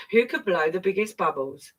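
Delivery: usual speaking voice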